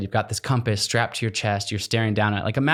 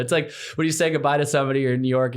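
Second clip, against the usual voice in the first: ordinary delivery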